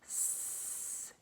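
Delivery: a soft, unvoiced "s" hiss; s hiss demo. Shown as a demonstration. Teacher modelling the hissing "s" sound for pupils softly. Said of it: "S also can sound like s".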